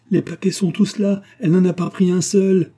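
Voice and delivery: high, loud, frantic exclamation; affolée. A narrator's reading. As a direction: cry it out in panic. Mère affolée découvre le tiroir. (cri d'affolement, aigu et fort)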